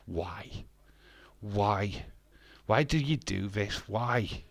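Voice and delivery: ordinary talk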